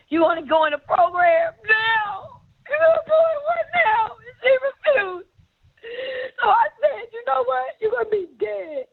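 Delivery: high-pitched, crying outburst; tearful fury. Real, not acted. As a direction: cry out in anguished anger, stretching the words. Reliving a tearful, furious confrontation.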